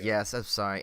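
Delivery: ordinary talk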